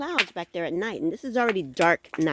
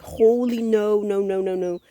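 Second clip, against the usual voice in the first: louder alarmed string of no's; retold fright; 4 semitones higher, 4 dB louder than usual. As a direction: back away from the noise. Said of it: "I'm like".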